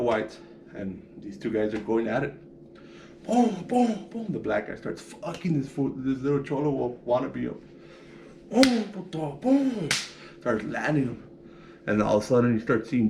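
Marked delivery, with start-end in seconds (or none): 3.2-4.3 high, quick booms for each punch; mock punch sounds. Recounting the jail fight, he mimics each punch with booms. 8.5-9.9 high booms spat around a taunt; mock punch sounds with a taunt.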